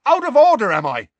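very high, loud shouted challenge. A narrator's reading. Seamus shouting at Ron, very high and loud. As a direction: shout it back in his face. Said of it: "shouted Seamus".

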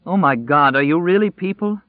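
high and loud, near sobbing disbelief; disbelieving. Acted. Woman asks Nadine if they're real, loud high disbelief. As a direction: cry it out, can't believe her eyes.

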